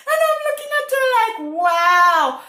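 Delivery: high, rushed lead-in to a loud wow; amused disbelief. Retold or imitated. Retelling the lady's remark to viewers, amused disbelief. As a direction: squeal it in laughing astonishment. Incredulous reaction retold.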